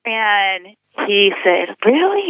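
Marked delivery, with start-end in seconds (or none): 1.8-2.3 high, incredulous retold question; surprised. Boyfriend hearing who the pastor is, surprised. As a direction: pitch it way up in surprise.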